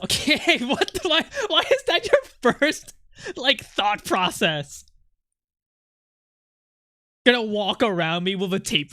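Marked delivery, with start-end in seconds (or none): 0.0-4.8 higher-pitched, incredulous through laughter; laughing disbelief. Real, not acted. Streamer reacts to chat wanting to hear burps, laughing in disbelief. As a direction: laugh while questioning their logic.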